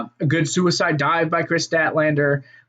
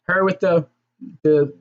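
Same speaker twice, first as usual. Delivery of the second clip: usual speaking voice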